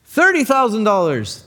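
called out high and loud across a crowd; rival's bid. Acted. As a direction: shout the bid from far back. Rival bids across auction crowd.

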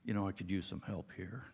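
composed radio call, deliberately controlled; very calm. Acted. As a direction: say it evenly as if nothing is wrong. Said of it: "you very calmly say".

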